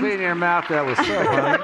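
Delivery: high, laughing mock scold; laughing tease. Real, not acted. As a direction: tease them for swearing, through laughter. Teasing a cohost for swearing.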